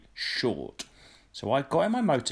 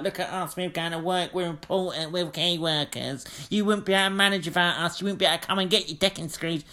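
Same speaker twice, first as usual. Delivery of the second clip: smug mockery; 5 semitones higher than usual. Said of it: "like the fucking Reservoir Dogs".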